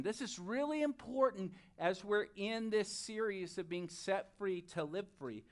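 normal talking voice

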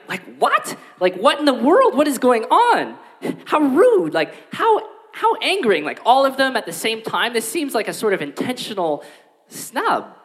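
high, incredulous what-in-the-world; indignant host. Pretend. Host reacting as every guest walks out, high and incredulous. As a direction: play the baffled offended host.

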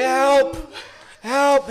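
high, loud re-enacted cry for help; screaming for help. Man in pain calling his housemate, acted scream. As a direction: call out loudly as if to another room. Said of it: "screaming in pain, hoping that someone would fucking hear me".

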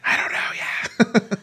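slow breathy whisper. Real, not acted. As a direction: hush it, slowly. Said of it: "why are we whispering?".

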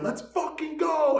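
loud, high, drawn-out yell; screaming cheer. Retold or imitated. Watching hockey in the kitchen, screaming drawn-out cheer. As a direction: scream it like a fired-up fan. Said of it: "So I'm screaming".

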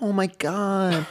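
high, loud, drawn-out fangirl squeal; mock gushing American. Americans meeting a British person, mock starstruck gushing. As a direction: gush slowly, way up high. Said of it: "everyone's like".

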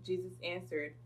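usual speaking voice

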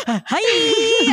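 high, loud, swoony squeal; smitten greeting. Acted. Swooning at his grin, high excited squeal. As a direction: gush it as if star-struck.

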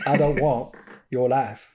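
slow, lightly drawled Texan quote; lightly voiced teen Texan; a hint of a Texas drawl; mock Texas drawl. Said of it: "one of my go-tos".

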